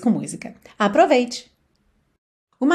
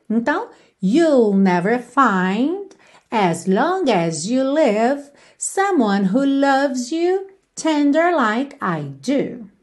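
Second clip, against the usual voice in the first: slow demonstration reading; much slower than usual. Shown as a demonstration.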